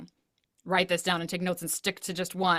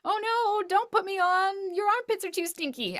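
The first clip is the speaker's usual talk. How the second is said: much higher, mock-horrified little shirt; talking shirt voice; 13 semitones higher, 4 dB louder than usual. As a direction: play the shirt refusing to be worn, over the top. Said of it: "the shirt's like".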